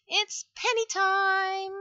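ordinary delivery

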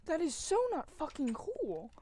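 loud, high angry shout; furious outburst. Real, not acted. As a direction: yell out the frustration at dying. Just died, angry outburst.

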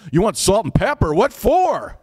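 lightly voiced incredulous local, pitch rising high; mock disbelief, climbing to a high finish; incredulous Minnesota server. Minnesota server asked for seasoning, mildly voiced incredulity.

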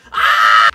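high delighted shriek with a laugh; excited shriek. Real, not acted. Opening a fan's letter, squealing with delight. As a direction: squeal at the gift. Excited reaction to photo gift.

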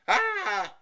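high, loud yelp of shock. A narrator's reading. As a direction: yelp like a startled boy. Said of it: "he yelped".

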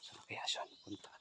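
breathy, slow whisper while searching; low searching whisper; hushed whisper. Real, not acted. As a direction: whisper so the creature won't hear. Searching quietly for the child.

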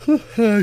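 long yawn that rises high; big yawn. Real, not acted. Sleepy host yawning in the middle of the story, real yawn. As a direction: let out a tired yawn mid-story. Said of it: "sorry, yawning".

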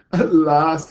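loud delighted announcement; exclaiming. A narrator's reading. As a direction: burst out with it proudly. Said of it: "he exclaimed".

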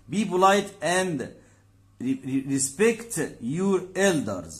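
slow teaching read. Shown as a demonstration. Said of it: "teacher reads English example".

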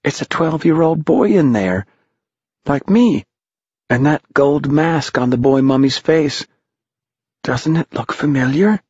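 put-on high boy's voice; high, wondering boy character; young Ralph. A narrator's reading. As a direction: pitch it well up, boyish. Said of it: "said Ralph".

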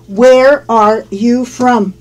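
slow, careful teacher's question; deliberate, drawn-out "Where are you from"; slow model. Shown as a demonstration. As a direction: stretch out each word for learners.

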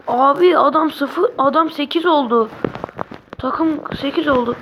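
stunned excited outcry; excited disbelief. Real, not acted. Shock at the score.

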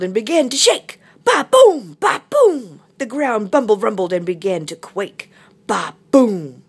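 loud, bouncing rumble with booming effects; dramatic narration. Acted. Narrator as the ground quakes, loud excited storytelling. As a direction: punch out each "boom".

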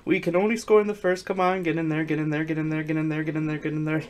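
high, rapid chant; frantic urging. Real, not acted. Player urging the team in, frantic chanting. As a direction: rattle it off high, running the repeats together.

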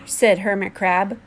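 plain reading voice

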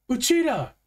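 high, loud call to a friend; urgent high-pitched holler; yelled name. A narrator's reading. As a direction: yell out to get their attention. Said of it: "I yelled".

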